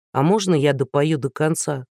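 usual speaking voice

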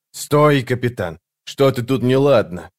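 plain reading voice

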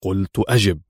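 low-pitched, restrained order; firm. A narrator's reading. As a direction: insist firmly, voice kept down. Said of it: "وهو يضيف في حزم". (بحزم وصوت خفيض)

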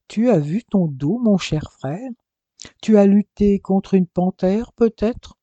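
gleeful mockery; teasing. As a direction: tease him about the scratches. Teasing brother about scratches.

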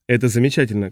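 plain reading voice

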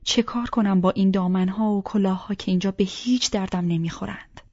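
ordinary delivery

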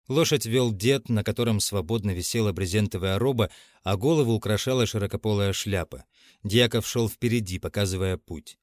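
ordinary delivery

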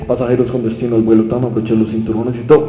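low, loud flight announcer; cabin announcement. Acted. Addressing passengers with destination to the flight, cabin announcement. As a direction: rattle off a mock passenger announcement. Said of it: "están en un avión y dicen".

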